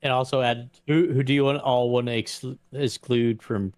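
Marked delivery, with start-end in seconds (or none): none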